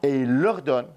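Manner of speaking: normal talking voice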